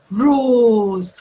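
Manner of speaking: loud, drawn-out "roars" into a roar; imitated lion roar. Shown as a demonstration. Teacher shows children what the lion does, mock roaring.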